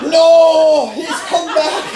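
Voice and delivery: high, laughing teacher's groan of dismay; laughing mock dismay. Teachers at the school groaning as the curly-haired boy returns, laughing. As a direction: squeal it through laughter like a horrified teacher.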